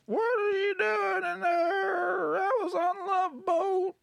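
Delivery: very high, drawn-out mock screech; screeching mom impression. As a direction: shriek it slowly like a cartoon mom. Imitating the mom yelling.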